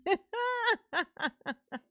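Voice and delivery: high breathy giggle; laugh. Real, not acted. Streamer giggles after the tomato chant, high. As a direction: giggle at getting it out.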